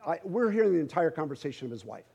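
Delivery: ordinary talk, nothing marked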